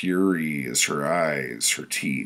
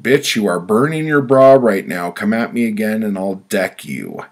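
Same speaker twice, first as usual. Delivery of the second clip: loud, raised-pitch angry warning; shouted threat; 4 semitones higher, 8 dB louder than usual. A narrator's reading. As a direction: shout it as a threat, easing slightly toward the end. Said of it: "I shouted".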